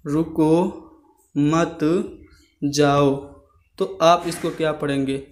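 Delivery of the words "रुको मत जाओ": each word on its own, long gaps between. Shown as a demonstration. Teacher dictating a sentence as it is written, word by word. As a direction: say it slowly, one word at a time. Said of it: "मैं आपको अगर एक sentence लिखूं".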